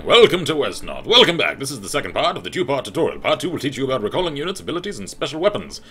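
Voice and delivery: loud, high tutorial welcome; announcer. Acted. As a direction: proclaim it like a game-show host. Reads out the tutorial's welcome text.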